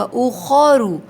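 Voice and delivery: اخرو with a full rounded خو; heavy khu. Shown as a demonstration. Teacher correcting students, heavy khu. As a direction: fill the mouth on خو. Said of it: "خو ہوگا".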